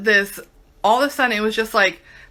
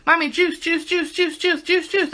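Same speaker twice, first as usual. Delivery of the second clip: put-on high, repeated little-kid begging; imitating a whiny kid; 7 semitones higher than usual. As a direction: chant juice like a toddler. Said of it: "they would want".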